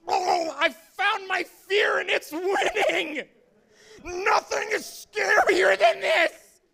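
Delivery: high, loud, drawn-out tearful scream; terrified wail. Acted. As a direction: wail in hammed-up terror. Acting out terror while vomiting blood.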